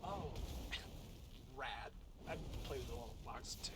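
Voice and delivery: low-pitched, offhand classmate voice; understated cool-kid delivery; casual classmate. Acted. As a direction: keep it low and unimpressed. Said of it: "she looks at you and goes".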